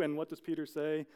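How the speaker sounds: ordinary talk, nothing marked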